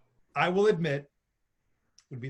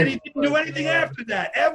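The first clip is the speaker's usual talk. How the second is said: fast, louder and higher rant; exasperated outburst; 8 semitones higher, 4 dB louder, faster than usual. Real, not acted. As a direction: blurt it out in disbelief. Rant about a forgotten one-game star.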